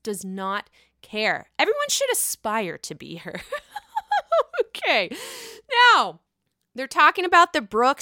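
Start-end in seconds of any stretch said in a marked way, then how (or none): 3.5-4.5 high-pitched giggle; high laugh. Real, not acted. Host laughs after gushing over Ion, high giggle. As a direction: let out a high, drawn laugh.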